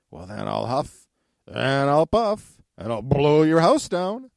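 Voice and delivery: building loud wolf threat. Acted.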